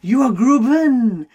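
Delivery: put-on sprightly old woman, loud and bright; lively old voice. A narrator's reading. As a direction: play a spry old lady. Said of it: "a sprightly old woman".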